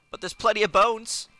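normal talking voice

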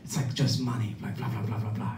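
soft, offhand muttered imitation of a bragger; mumbled impression of someone talking big; mock rambling trash talk. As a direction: toss off the boasts under your breath. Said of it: "just talking shit".

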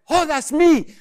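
loud, high startled call; sudden realisation. Acted. Forgetful customer realising he is Tom, startled shout.

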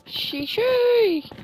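sheesh belted up high; loud high sheesh. Acted. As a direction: belt the sheesh out. Sheesh said on demand.

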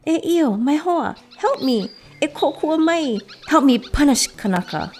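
high-pitched little bird's plea; storybook begging, high and insistent; urgent appeal. A narrator's reading. Hurt bird begs friend for help.

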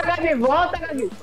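calling out. Real, not acted. Calling teammate back.

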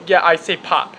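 ordinary talk, nothing marked

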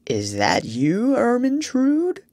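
murky half-awake king's voice, dragged out; sleepy. A narrator's reading. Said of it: "and said sleepily".

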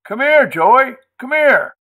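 high, loud holler starting at full force; raucous yell. A narrator's reading. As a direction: yell for the boy to come over. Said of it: "she yelled".

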